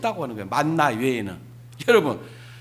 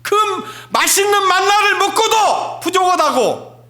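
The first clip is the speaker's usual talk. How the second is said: much higher, loud preaching shout; preaching at full force; 11 semitones higher, 6 dB louder than usual. Acted. Preacher shouting to the congregation, full-force preaching. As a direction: thunder it from the pulpit.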